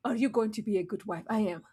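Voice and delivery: ordinary talk, nothing marked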